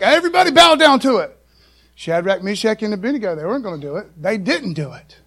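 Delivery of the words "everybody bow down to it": higher and louder, a mild mock royal order; king's command. Nebuchadnezzar ordering everyone to bow, mock-commanding. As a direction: bark it like a pompous king, half-hammed. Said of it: "And he's like".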